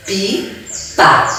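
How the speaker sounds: Pipa in two separate beats, pi, pa; syllable by syllable. Shown as a demonstration. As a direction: pause between the syllables of Pipa. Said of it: "eu abro a boca duas vezes".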